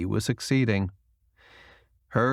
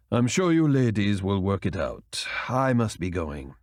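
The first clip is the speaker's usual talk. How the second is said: lightly voiced calm man; 2 semitones lower than usual. A narrator's reading. Julian leaves the table.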